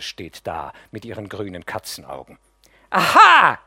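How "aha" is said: shrieking high and loud; hysterical. Acted. Mascha bursts in mocking the crying nephew, hysterical and loud. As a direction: burst in half mad with scorn. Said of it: "sagt sie hysterisch".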